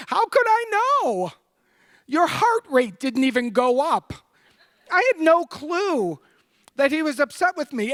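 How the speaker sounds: high, laughing disbelief; amused disbelief. Real, not acted. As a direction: marvel at how clueless she was.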